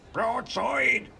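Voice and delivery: high shout, a little held back; high-pitched answering call; crew echoing order. A narrator's reading. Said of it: "repeated the crew".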